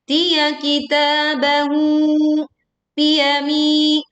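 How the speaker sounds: "Tiya kitabahu" chanted slowly, holding ta and hu; lengthened vowels. Shown as a demonstration. Teacher modelling two-count vowels, slow lengthened chant. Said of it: "sama-sama dibaca panjang".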